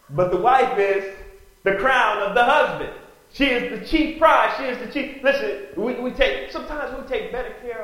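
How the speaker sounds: loud, high impassioned preaching. Real, not acted. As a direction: preach it with fire to the congregation. Preacher at full force.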